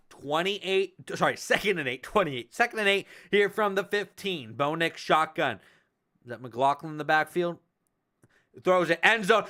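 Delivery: touchdown call then measured recap; live play-by-play. Acted. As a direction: call it like a TV booth. Streamer calls the game live.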